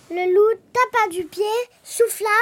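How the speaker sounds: plain reading voice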